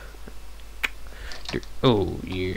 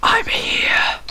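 whispering; 3 dB louder than usual. Real, not acted. Whispering excitedly after sneaking.

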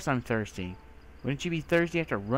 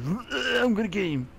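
mock zombie bawl; 8 semitones higher, 4 dB louder than usual. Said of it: "He was just bawling".

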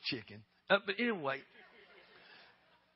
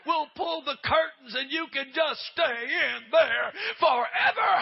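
high, loud, slow crying reply; tearful shout; 7 semitones higher, 6 dB louder, slower than usual. Retold or imitated. Preacher telling his sister to stay in the water, tearful shout. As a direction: shout it through tears.

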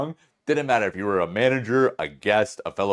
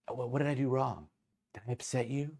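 soft, higher-pitched questioning; mild, softened confrontation; nicer-sounding bartender; 4 semitones higher, 8 dB quieter than usual. Retold or imitated. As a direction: lightly imitate her polite challenge. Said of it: "I'm making her out to be a, a, a nicer person".

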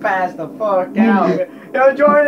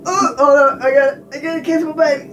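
high, quick mock excitement, louder; mock excited; 9 semitones higher, 3 dB louder, faster than usual. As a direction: blurt it out in a high rush before the kiss. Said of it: "I'll be like".

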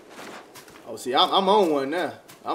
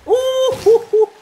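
high excited hooting; 5 dB louder, slower than usual. Real, not acted. Celebrating winning hits.

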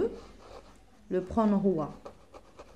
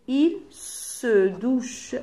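"Il se douche" very slow, word by word; slow dictation; 2 semitones higher, much slower than usual. Shown as a demonstration. Teacher fills in the rhyme with pupils, very slow dictation of "Il se douche". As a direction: stretch each word of "Il se douche" for writing.

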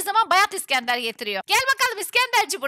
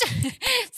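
high nervous-excited giggle; 4 semitones higher, 3 dB quieter than usual. Real, not acted. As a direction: laugh while making an escape. Laughs while fleeing. (kaçarken kıkırdama)

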